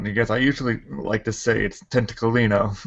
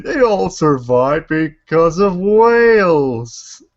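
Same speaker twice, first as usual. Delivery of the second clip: mock announcement; 5 semitones higher, 5 dB louder, much slower than usual. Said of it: "The last movie just said".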